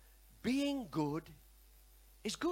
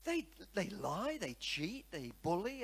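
ordinary talk

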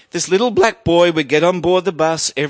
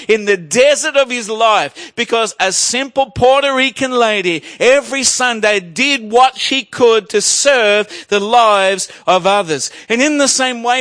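fervent preaching; 5 semitones higher than usual. Acted. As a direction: build it up for the congregation. Preaching rises to full force.

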